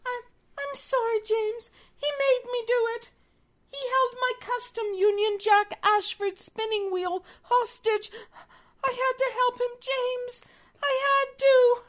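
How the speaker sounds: high-pitched, hammed-up remorse; pleading apology. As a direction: plead for forgiveness as a coerced traitor. Acted confession to betrayed partner.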